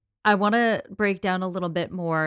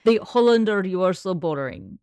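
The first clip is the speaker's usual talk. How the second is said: put-on Russian accent; 5 dB louder than usual. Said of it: "I can't do it in Russian accent".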